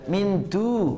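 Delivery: normal talking voice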